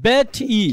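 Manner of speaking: "বেট ই" with a separate long final EE; hypothetical mispronunciation. Shown as a demonstration. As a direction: detach and draw out the ই in "বেট ই". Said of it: "এমন উচ্চারণ হওয়া উচিত না?". ("বেট ই", শেষে আলাদা লম্বা ই)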